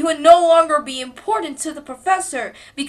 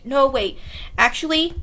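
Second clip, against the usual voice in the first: ordinary delivery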